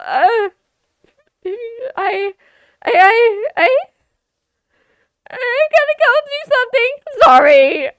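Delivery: loud, high flustered stammer; panicked shout. Acted. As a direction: blurt it out in panic. Flustered character panics and flees.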